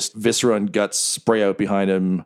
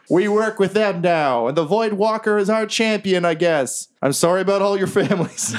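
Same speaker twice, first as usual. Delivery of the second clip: goblin leader speech; 10 semitones higher than usual. Acted. Jerry announcing the alliance, higher voice. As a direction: announce it grandly then falter. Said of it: "He's like".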